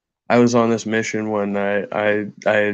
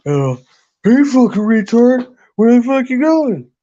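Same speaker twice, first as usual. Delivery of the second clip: high, loud jeering shout; much higher, loud mocking heckle at a cyclist; mocking yell; 12 semitones higher, 6 dB louder than usual. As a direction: yell it like a taunting teenager from a truck. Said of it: "they kept shouting at him".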